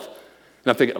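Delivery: ordinary talk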